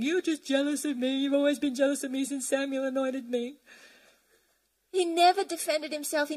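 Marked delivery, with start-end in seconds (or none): 0.0-3.6 hypothetical defensive David. Retold or imitated. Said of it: "He didn't get involved in defending himself, saying".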